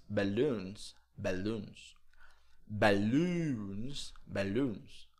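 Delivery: "balloons" with long U, "bæluns"; double O as U. Shown as a demonstration. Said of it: "vai ter o som de U". ("balloons" com OO soando U, "balúns")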